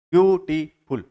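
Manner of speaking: "ब्यू… टी… फुल" in three separate beats; small gaps after ब्यू and टी in "ब्यूटीफुल"; split into syllables. Shown as a demonstration. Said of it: "तीन syllable है".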